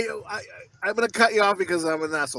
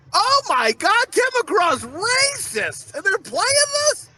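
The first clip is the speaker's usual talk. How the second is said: high-pitched, scandalized disbelief; hammed-up shocked outcry; mock outrage; 12 semitones higher, slower than usual. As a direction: react as if utterly scandalized. Acts out shock at song title.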